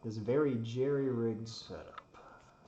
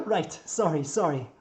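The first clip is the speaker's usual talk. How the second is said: loud high laugh into a sheepish sorry; laughing apology; 10 semitones higher, 10 dB louder, slower than usual. Acted. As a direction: laugh off the scolding, higher-pitched. Builder laughs off a scolding.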